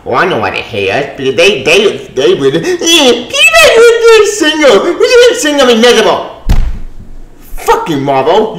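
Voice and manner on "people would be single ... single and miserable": laughing yell. Real, not acted. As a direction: crack up while yelling it. Ranting, laughing in disbelief.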